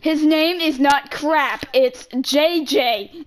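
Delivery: spelled out slowly like a teacher; slow, careful naming for them to copy; mock teacher naming slowly; slow and deliberate. Shown as a demonstration. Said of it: "Now pronounce it for me".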